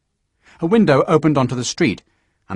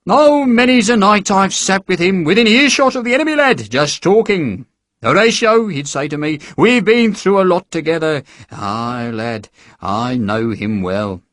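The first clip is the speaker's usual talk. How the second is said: higher, lightly voiced hearty sergeant; jovial recruiting sergeant; 6 semitones higher than usual. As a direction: charm the lad into signing up. Sergeant reminiscing boastfully.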